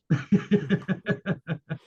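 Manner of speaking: short chuckling laugh; amused laugh after a dare. Real, not acted. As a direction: chuckle in a quick run of pulses. Laughs after daring them to call.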